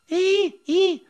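imitated seabird screech, very high and loud; shrill bird cry. Shown as a demonstration. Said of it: "very shrill, high-pitched voice".

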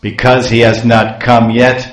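loud, outraged storyteller's curse; angry cursing. Acted. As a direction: hurl the curse loudly, hammed-up fury. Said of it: "here he's speaking some ill names".

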